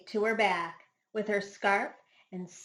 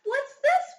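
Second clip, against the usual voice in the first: high, louder surprised question; puzzled wondering; 6 dB louder than usual. A narrator's reading. Duck wonders aloud at the duckling, high and surprised. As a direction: Duck peers at the strange little thing. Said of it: "wondered Duck".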